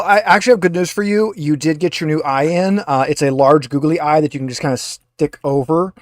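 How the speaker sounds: ordinary talk, nothing marked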